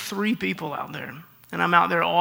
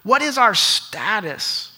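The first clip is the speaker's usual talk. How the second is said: slow, deliberate pulpit delivery; preaching the point; much slower than usual. Acted. Pastor driving home his point to Christians, slow and earnest. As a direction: draw each phrase out for the congregation.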